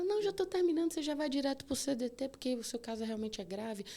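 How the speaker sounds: high, brisk triage nurse voice; insistent nurse. Retold or imitated. Triage nurse insisting on finishing vital signs, high and brisk. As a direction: keep going over her protest.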